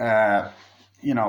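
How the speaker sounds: ordinary delivery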